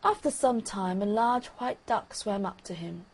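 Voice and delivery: plain reading voice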